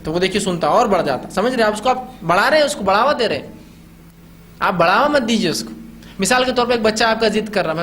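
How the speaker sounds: usual speaking voice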